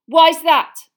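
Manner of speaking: high, very loud, furious question; spat out angrily. A narrator's reading. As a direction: spit the question at him. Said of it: "Harry spat".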